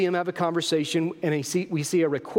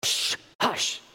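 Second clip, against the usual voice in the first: sharp unvoiced shush from the crowd; hushing; 5 semitones higher than usual. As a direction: shush them with a breathy hiss. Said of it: "what does everybody else say?".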